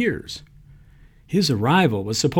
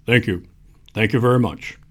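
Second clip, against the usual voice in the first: [name] impression; 3 semitones lower than usual. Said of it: "his best [name] impression".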